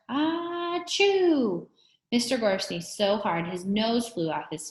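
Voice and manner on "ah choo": loud, high barked sneeze; explosive high sneeze. A narrator's reading. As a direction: bark out a big sneeze. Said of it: "Todd barked".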